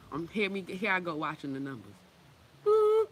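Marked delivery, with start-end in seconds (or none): 2.6-3.1 high squeaky bloop, loud; a cartoon beep, way up high; register bloop. Cashier's register ringing up items, a high loud bloop. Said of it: "She's like".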